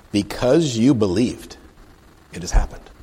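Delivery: slow, weighty pronouncement; deliberate scripture reading. Acted. Jesus to the Roman officer, slow scripture pronouncement. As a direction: pronounce it with a drawn-out emphasis. Reads verse aloud, then repeats it.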